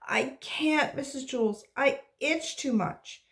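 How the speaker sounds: put-on high, whiny child's plea; whining. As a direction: whine it like a miserable kid. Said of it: "Dana whined".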